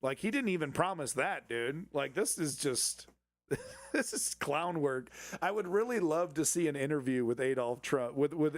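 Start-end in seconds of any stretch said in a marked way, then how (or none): none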